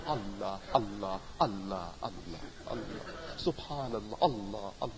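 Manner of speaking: low, hushed, swaying chant of Allah; rocking in bliss. Retold or imitated. A translator rocking in bliss, softly murmuring Allah. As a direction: imitate a listener lost in rapture, murmuring Allah. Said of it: "he'd be rocking in bliss".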